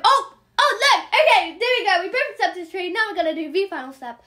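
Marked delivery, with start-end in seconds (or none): 0.0-2.0 excited. Real, not acted. Trade suddenly accepted.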